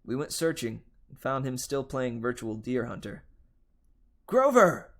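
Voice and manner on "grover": loud, high call across the casino; full shout. Acted. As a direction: yell his name to snap him out of it. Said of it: "we both shouted".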